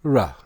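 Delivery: "Ra" as one isolated syllable; "Ra" with an open ah; pronunciation demo. Shown as a demonstration. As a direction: sound out "Ra" for learners. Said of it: "How is it pronounced?".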